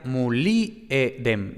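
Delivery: muliedem said slowly, stress on li; pronunciation demo. Shown as a demonstration. Teacher demonstrating the classical Latin stress of muliedem. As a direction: spell out muliedem with the accent on li. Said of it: "se pronuncia".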